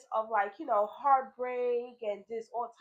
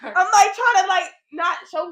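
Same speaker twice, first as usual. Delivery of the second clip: much louder and higher, slowing at the end; excited; 8 semitones higher, 14 dB louder than usual. Real, not acted. Showing her friend how she hid her crying, loud and amused. Acts out hiding tears, laughing.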